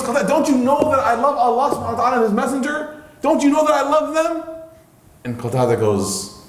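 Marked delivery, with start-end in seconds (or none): 0.0-4.4 high, straining pleading voice; desperate tearful plea. Retold or imitated. Ka'b begging his cousin to answer, high desperate plea. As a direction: beg him to believe you, near tears. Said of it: "And he's crying".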